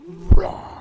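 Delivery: high loud playful roar. Shown as a demonstration. Reading to children, jaguar roar. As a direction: let out a big cartoon roar. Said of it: "what does a jaguar say?".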